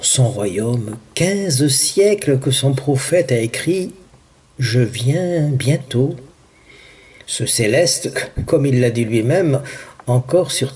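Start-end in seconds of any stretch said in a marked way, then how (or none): 4.6-6.2 slow, drawn-out promise; solemn prophecy. Acted. Prophet relaying the promise of return, slow and solemn. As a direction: let each word stretch out slowly.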